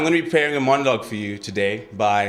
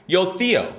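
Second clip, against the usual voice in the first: higher-pitched hail to a friend; calling out; 8 semitones higher than usual. Acted. Teen hailing friend Theo across the road, calling out. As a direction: call a mate over from across the road.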